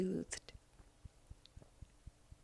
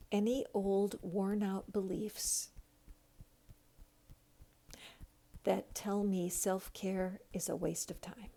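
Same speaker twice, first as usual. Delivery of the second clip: slow, hushed affirmation voice; guided affirmation. Acted. Leads a tapping affirmation.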